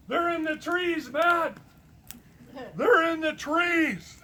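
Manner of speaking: high, loud imitated shout; yelling. Caller yelling at me down the phone, imitated full shout. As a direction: holler it like a frantic caller, drawing out the repeat. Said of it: "he'd be yelling at me".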